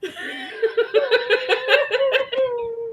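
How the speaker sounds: snorting laughs. Real, not acted. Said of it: "Did you just snort".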